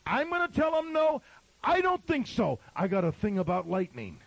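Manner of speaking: loud, high, incredulous pulpit shout; indignant preaching. Real, not acted. Pastor on refusing a missionary a service, indignant preaching. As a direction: fire back in outraged disbelief, building to a peak.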